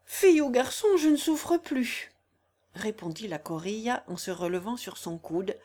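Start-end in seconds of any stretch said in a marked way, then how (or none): 0.1-2.1 careless new mother. Acted. Corilla answers the maid, dismissive and loud. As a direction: fling it off with relief. Corilla brushes off her baby.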